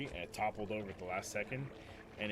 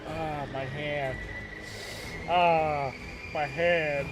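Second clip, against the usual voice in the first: slow, drawn-out mock groans, getting louder; fake pain moaning; 4 semitones higher, 6 dB louder, much slower than usual.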